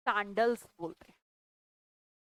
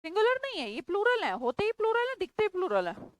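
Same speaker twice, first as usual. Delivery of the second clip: emphatic insisting; 7 semitones higher than usual. Real, not acted. Teacher insisting to students these nouns are plural, raised and emphatic. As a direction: drive the point home to the class.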